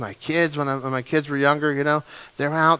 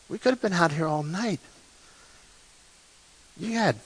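softer, gentle loving rebuke; tender Jesus; 4 semitones higher, 8 dB quieter than usual. Retold or imitated. Jesus holding sinking Peter, soft and tender. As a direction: lift him up with a soft, loving question. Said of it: "He picked him up and he says".

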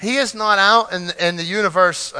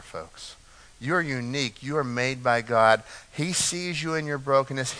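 hushed, tender preaching; 5 semitones lower, 8 dB quieter than usual. Real, not acted. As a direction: drop much softer and lower, close and intimate. Tender hushed reassurance to congregation.

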